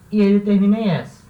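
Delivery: ordinary delivery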